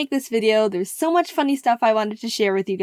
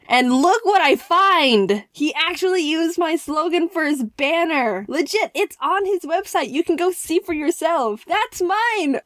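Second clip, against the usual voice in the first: excited discovery; 6 semitones higher than usual. Real, not acted. Finding her slogan on website.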